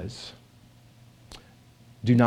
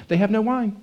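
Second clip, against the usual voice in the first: "They have no wine" said higher as a bare statement; just state it, no request; flat statement read; 8 semitones higher than usual. Shown as a demonstration. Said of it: "It just sounds like a statement".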